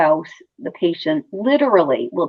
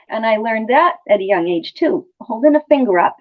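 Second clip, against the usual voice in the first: normal talking voice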